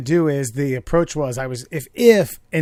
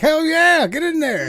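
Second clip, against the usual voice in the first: high, loud mock cheer; mock hype; 13 semitones higher, 5 dB louder than usual. Teasing his friend with a mock cheer, high and loud. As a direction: cheer him on, hammed-up. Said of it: "you'd have been like".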